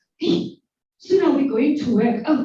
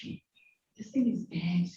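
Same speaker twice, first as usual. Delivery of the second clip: scared whisper; 8 semitones lower, 8 dB quieter than usual. Retold or imitated. Retelling her frightened realisation.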